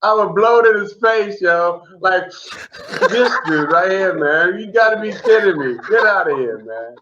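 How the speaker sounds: words half laughed out, pitch up; can't keep a straight face telling it; laughing through it. Real, not acted. Comic laughing while telling his buddy the prank.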